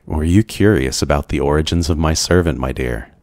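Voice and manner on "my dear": ordinary delivery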